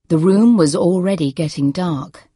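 ordinary delivery

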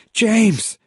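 high, loud cry of his name; urgent high-pitched call. A narrator's reading. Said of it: "she cried".

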